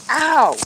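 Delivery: sharp high ow of pain; real yelp as the dog pulls; sudden pain yelp. Real, not acted. Dog pulls her hand.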